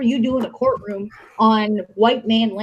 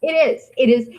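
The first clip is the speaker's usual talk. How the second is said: ordinary delivery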